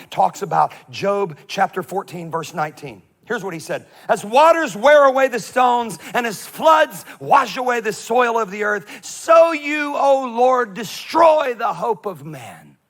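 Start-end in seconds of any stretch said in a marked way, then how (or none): 4.1-12.8 shouted, high, drawn-out lament to God; anguished declamation. Acted. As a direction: cry it out slowly, then let it fall on the last line. Declaims Job's despairing verse to God.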